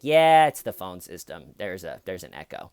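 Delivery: lightly voiced low-pitched dispatcher; imitated dispatcher. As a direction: explain it routinely, dropping away at the end. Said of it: "the dispatcher's like".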